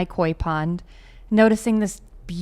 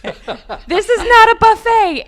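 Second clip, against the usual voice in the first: slow, high, loud mock outrage; retold yelling; 13 semitones higher, 8 dB louder, slower than usual. Pond owner yelling at the fish-eating heron, laughing retold yell. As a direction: holler it high, drawing out each word.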